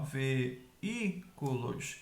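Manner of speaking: "veículos" very slowly as ve... í... cu... los; teacher spacing out "veículos", pausing between syllables; split into syllables. Shown as a demonstration. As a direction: break "veículos" into separate syllables. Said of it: "em sílabas separadas".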